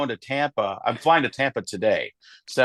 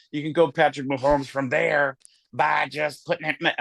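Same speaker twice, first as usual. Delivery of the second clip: ordinary delivery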